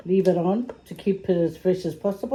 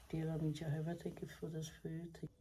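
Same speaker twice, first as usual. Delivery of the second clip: prayer; 18 dB quieter than usual. Real, not acted. Saying grace before eating.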